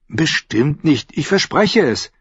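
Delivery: put-on high, slow boyish promise; boy's voice. A narrator's reading. Bran promising Jojen, high slow boyish voice. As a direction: promise it earnestly like a boy.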